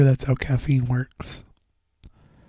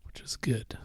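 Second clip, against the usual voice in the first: whispering; 2 semitones lower, 12 dB quieter than usual. Real, not acted. Aside while talking.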